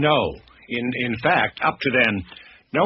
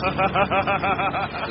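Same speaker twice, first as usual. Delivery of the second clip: a high cackling burst of laughter; wild laugh; 7 semitones higher than usual. Acted. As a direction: laugh like a man who finds doom funny. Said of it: "He began to laugh".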